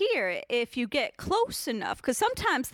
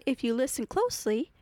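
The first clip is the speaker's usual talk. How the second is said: ordinary delivery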